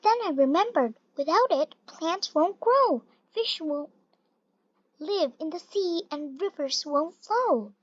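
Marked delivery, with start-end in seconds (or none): none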